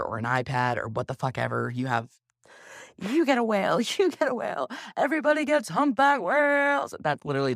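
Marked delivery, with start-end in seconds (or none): 3.0-6.9 higher, louder mock talk-show host shouting out prizes; Oprah giveaway. Oprah handing out prizes to the studio audience, higher, loud and excited. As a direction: ham up Oprah handing out gifts to her audience. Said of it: "that's how I feel about Oprah".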